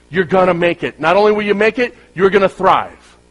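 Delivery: loud, high, rousing sermon delivery; rally them with a booming, urgent charge; full-force preaching. Acted. Preaches Joshua passage at full force.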